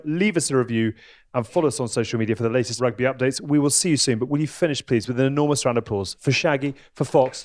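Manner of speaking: ordinary talk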